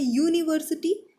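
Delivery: "यूनिवर्सिटी" begun with a clear y sound, yoo; "university" with a y sound. Shown as a demonstration. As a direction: stress the y sound at the start of "यूनिवर्सिटी". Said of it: "इसका उच्चारण य से हो रहा है". ("यूनिवर्सिटी" का उच्चारण य की ध्वनि से)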